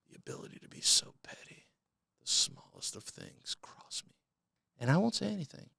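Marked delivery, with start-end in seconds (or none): 0.1-4.1 whisper. Real, not acted. Admitting his pettiness to viewers in a whisper. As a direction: let the petty secret out in a whisper.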